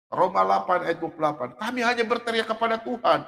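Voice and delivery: ordinary talk, nothing marked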